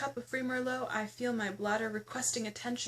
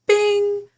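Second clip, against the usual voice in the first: very loud, high "bing" like a seatbelt chime; imitated chime; 9 semitones higher, 15 dB louder than usual. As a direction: blast it like the cabin bell.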